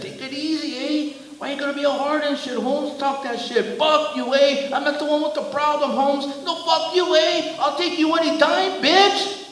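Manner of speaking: very high, agitated driver; high-pitched protest. A narrator's reading. Driver arguing back at the giant, agitated and defiant.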